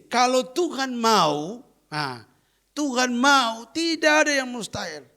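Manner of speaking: high, loud, slow on "kalau Tuhan mau"; preacher urging the congregation that nothing is impossible; preaching at full force. Acted. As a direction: proclaim the promise to the church. Preaching the key verse.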